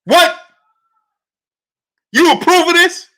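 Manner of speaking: loud, high-pitched disbelief; incredulous outrage. Real, not acted. Host challenging chat for approving, incredulous outrage. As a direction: burst out as if you can't believe it.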